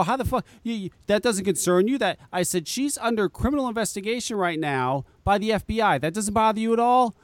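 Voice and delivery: high-pitched, incredulous questioning; retold incredulity. Son challenging his mother about the candidate, incredulous. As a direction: replay the disbelief, pitched high.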